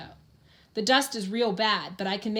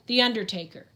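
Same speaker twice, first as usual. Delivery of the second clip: ordinary delivery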